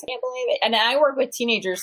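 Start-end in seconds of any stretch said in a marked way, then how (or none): 0.0-0.6 mock shock, higher and softer. People reacting to her age, mock shocked, higher and softer. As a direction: imitate people's disbelief, pitched up. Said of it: "A lot of people are, like, shocked".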